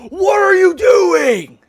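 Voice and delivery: stretched out, high, loud; drawn-out outraged yell; exasperated shout. Real, not acted. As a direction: yell it slowly in disbelief. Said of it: "reacting to clip".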